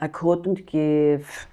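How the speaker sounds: put-on very slow, low Polish Dublin accent. Replaying the beautician, drawn-out Polish Dublin accent. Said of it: "Polish Dublin".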